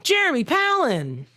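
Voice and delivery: put-on stiff, high line reading like a child actor; bad child actor. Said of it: "Just a bad child actor".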